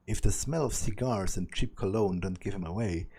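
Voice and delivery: usual speaking voice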